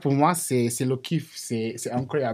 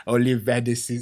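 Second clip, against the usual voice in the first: ordinary talk, nothing marked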